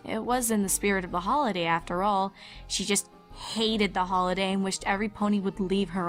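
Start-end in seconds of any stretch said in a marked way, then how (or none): none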